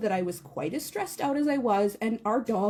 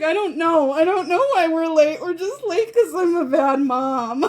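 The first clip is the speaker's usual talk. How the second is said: louder, higher, exasperated answer; a frazzled mom, louder and half laughing; desperate loud; 5 semitones higher, 7 dB louder than usual. Retold or imitated. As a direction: blurt it out near tears.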